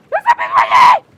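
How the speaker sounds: high, loud panicked yell; panicked shout. Real, not acted. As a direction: scream it in panic. Panics while drowning in game.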